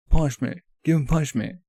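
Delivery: lightly hammed-up call to punish; acted outcry. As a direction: call it out as a pretend angry pursuer.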